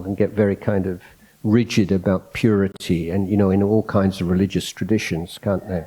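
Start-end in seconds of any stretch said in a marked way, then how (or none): none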